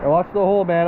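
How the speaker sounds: ordinary delivery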